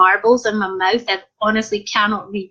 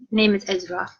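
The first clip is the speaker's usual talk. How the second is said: normal talking voice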